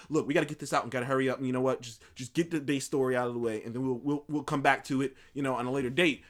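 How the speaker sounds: low, soft, rapid-fire brush-off; imitating a studio in a hurry; hurried studio talk. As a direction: rush it through quietly, like business to get done. Acting out studio hurrying the film.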